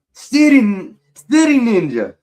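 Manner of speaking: loud, high hype call; excited shout-out; shouted nickname. Real, not acted. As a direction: belt the new nickname out with a grin.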